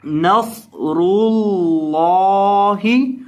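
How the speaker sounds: नसरुल्लाहि with the सौद and रॉ said full and heavy; slow chant of नसरुल्लाहि with thick, full-mouthed letters; heavy sad and ra. Shown as a demonstration. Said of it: "सौद को मोटा करेंगे".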